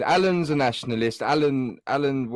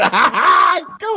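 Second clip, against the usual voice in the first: sudden loud burst of laughter; 9 dB louder than usual. Real, not acted. Laughing loudly while the call keeps dropping.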